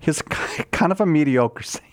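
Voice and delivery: whispered with a laugh; a laughing whisper quoting Father Guido Sarducci; mock whisper. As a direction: whisper it as a comic aside. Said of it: "he said".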